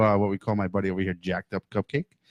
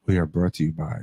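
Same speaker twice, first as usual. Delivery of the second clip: sexy voice; 6 semitones lower than usual. Acted. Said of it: "Uh-oh, sexy voice".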